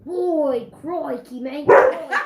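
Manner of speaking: mock Australian, crikey drawn out; playful Australian accent for the viewers. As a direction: ham up an Aussie bloke, Australian accent. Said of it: "can you do an Australian accent".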